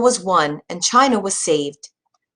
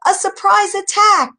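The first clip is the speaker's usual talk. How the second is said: much higher alarmed shout; urgent call; 14 semitones higher, 4 dB louder than usual. A narrator's reading. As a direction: raise the alarm, pitched high. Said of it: "he called".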